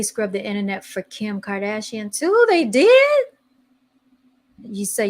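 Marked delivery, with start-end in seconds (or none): 2.5-3.2 startled, incredulous question; surprised. Real, not acted. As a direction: pitch jumps way up, louder. Reacting to surprising news.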